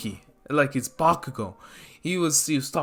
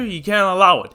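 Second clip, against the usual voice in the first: ordinary delivery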